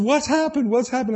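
high, anxious mother asking; a mother worried on the phone; mom's worried voice. Retold or imitated. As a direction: imitate mom asking what's wrong. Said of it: "And she said".

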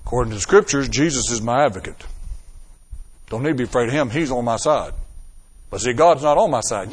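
a hint of smug religiosity; voice of religion. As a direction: mimic the religious know-it-all. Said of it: "here's where religion jumps in".

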